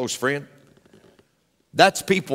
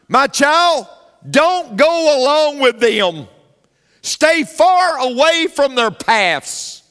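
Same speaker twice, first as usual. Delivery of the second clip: higher, louder, slow deliberate scripture read; preaching scripture; 8 semitones higher, 5 dB louder, slower than usual. Acted. Pastor reads Proverbs warning to the congregation, slow and loud. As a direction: lay each verse down slowly with weight.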